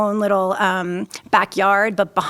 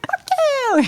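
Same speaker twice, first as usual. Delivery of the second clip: very high, hammed-up greeting insult; mock cheery curse. New Yorker answering a morning greeting, mock cheery cursing. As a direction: toss the insult like a good morning.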